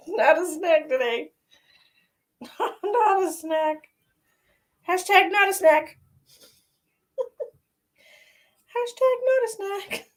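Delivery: cheeky self-mocking catchphrase; playful. Real, not acted. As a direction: tease yourself in a singsong. Joking about her looks.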